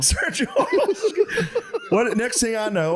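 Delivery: high, wheezy laughing words; laughing in character. Acted. Father bit collapses, laughing through words. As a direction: barely hold the dad voice.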